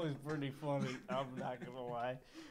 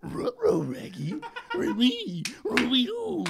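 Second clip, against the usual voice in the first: loud, high cartoon dog talk; Scooby-Doo; 10 semitones higher, 14 dB louder, much slower than usual. Answering Shaggy as his talking dog, loud Scooby-Doo voice. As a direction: howl the words like a cartoon dog. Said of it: "And then".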